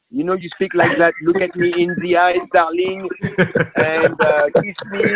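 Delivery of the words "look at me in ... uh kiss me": romantic husband. Pretend.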